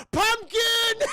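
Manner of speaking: very high, loud, drawn-out yell; stretched high-pitched holler; blurted shout. Retold or imitated. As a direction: scream the code word in a hammed-up panic. Character blurts out the secret word.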